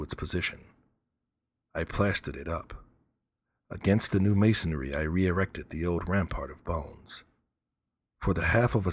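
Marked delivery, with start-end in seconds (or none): none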